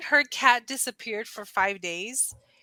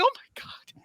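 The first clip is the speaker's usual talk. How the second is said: much higher, stunned exclamation; shocked; 14 semitones higher than usual. Retold or imitated. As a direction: gasp it out at the bloody fur. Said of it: "I was like".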